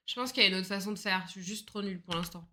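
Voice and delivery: ordinary talk, nothing marked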